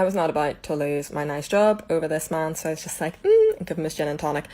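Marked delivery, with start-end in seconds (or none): 3.2-3.6 high, loud forced "mm"; biting back a reply; tight-lipped hum. Retold or imitated. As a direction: squeak out a closed-mouth hum. Holding back to keep job.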